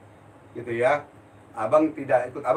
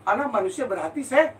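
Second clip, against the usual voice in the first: high, loud sermon climax; higher, louder hellfire preaching; fiery preaching; 7 semitones higher, 5 dB louder than usual. Acted. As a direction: preach it with rising fury. Preaching at full force.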